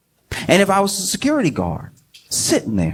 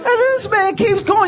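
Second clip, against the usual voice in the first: incredulous shout; slower than usual. Real, not acted. Comedian reacting to [name].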